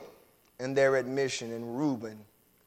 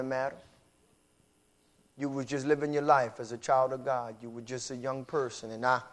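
quiet, low confessing voice, much softer; soft confession; 7 semitones lower, 8 dB quieter than usual. Acted. A wrongdoer confessing to the one he hurt, softly. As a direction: confess it gently, head down.

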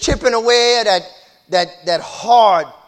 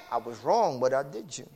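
quiet confession; 7 semitones lower, 8 dB quieter than usual. Acted. Voiced confession, soft.

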